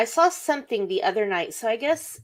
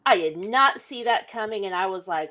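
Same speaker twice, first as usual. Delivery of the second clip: ordinary delivery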